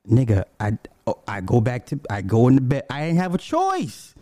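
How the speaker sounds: ordinary talk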